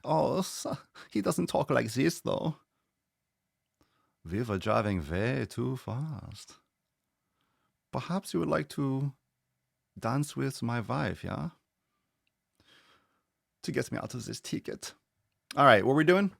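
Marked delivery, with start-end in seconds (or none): 0.0-2.6 put-on camp German accent, pitched up; hammed-up, flamboyant German voice. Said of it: "a little bit more of a flamboyance". 4.3-14.9 hammed-up German movie voice; flamboyant German. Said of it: "a little bit more of a flamboyance".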